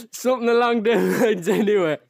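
high, loud, still laughing. Real, not acted. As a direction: talk through the giggles. Still laughing after joke.

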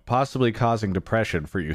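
usual speaking voice

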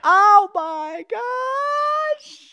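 high, long yelled "oh my gosh"; loud drawn-out shout. Real, not acted. Excited host shouting about the movie, loud and drawn out. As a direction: belt it out, stretched way out. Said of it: "Was that too loud?".

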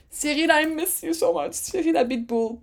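high mock wail of longing; playful lament. Fan to absent team boss, playful lament.

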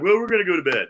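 friends turning in. Retold or imitated.

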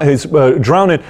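usual speaking voice

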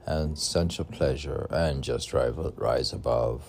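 loud, lilting recital of his own verse; poetry reading. Acted. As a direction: declaim each line like a stage poem. Author reads his own poem.

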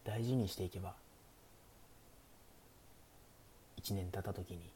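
soft, hushed bedtime greeting; sleepy bedtime stream voice. Pretend. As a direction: lull listeners toward sleep, half-whispered. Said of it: "寝落ち配信的なやってみるか". (眠くなるような寝落ち配信の声)

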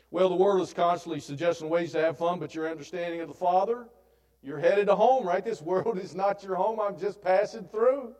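rousing pulpit delivery; loud preaching. Acted. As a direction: preach it out to the whole room. Preaching at full force.

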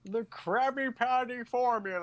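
high cartoon villain voice; high-pitched cartoon impression; Plankton. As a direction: attempt the tiny scheming villain. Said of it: "do Plankton from SpongeBob".

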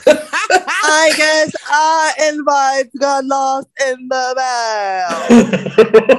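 slow line broken by laughter; cracking up mid-joke; laughing. Real, not acted. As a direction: push the words out through laughs. Joking while laughing.

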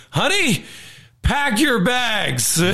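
high, slow triumphant call; newly rich husband tells his wife to pack; gleeful husband. Retold or imitated. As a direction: stretch it out, gloating.

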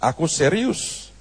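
low, soft and slow voice of God; God gently insisting he is serious; God speaking. Retold or imitated. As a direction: let God answer quietly and firmly. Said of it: "Tuhan bilang".

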